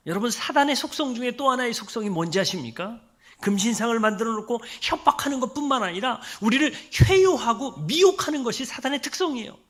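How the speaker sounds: usual speaking voice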